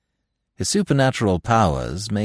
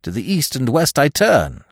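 higher, declaimed lament; crying out; 6 semitones higher, 4 dB louder than usual. A narrator's reading. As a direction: cry it out in theatrical despair. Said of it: "cried I".

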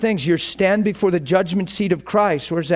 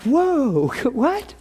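drawn-out high whoa, then a startled question; retold surprise; 5 semitones higher than usual. Pastor reacting to his wife's claim, mock startled surprise. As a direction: react taken aback, pitch jumping up. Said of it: "And I'm like".

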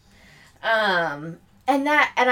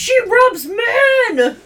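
slow, high, wailing yell; hammed-up high cry; mock wailing shout; 12 semitones higher, 6 dB louder, much slower than usual. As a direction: howl it in mock grief. Mock voicing the husband.

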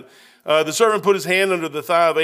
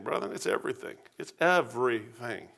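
softer, almost whispered; hushed intensity; 7 semitones lower, 10 dB quieter than usual. Real, not acted. Preacher to a brother in the pews, hushed and intent. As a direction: lean in and say it low. Preacher drops to intimate hush.

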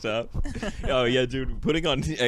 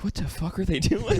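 higher-pitched incredulous question; mock bafflement; 8 semitones higher, 3 dB louder than usual. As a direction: act baffled at what you see. Acted onlooker baffled by food workers.

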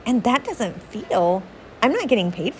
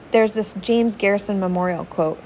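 ordinary talk, nothing marked

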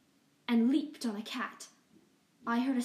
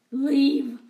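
loud, falling order to leave; loud command; 3 semitones higher, 13 dB louder than usual. Acted. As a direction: bark it as an order. Rat King orders cats out.

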